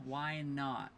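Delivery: normal talking voice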